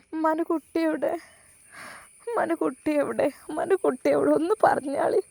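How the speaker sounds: put-on tearful old mother. Mother answers son's call.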